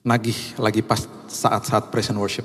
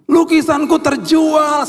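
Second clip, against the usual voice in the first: very high, loud, stretched-out squeal; child's shout; 6 dB louder, slower than usual. Retold or imitated. Child telling father the painting sold, shrieking shout. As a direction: imitate a thrilled child yelling, every word drawn out. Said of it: "dia teriak".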